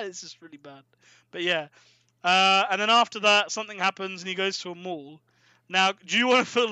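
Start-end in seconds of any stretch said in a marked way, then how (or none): none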